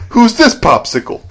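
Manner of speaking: high-pitched mock cool-guy jab; Fonz impression. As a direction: toss out a cheeky quip in a cartoonish impersonation. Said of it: "sounds like the Fonz".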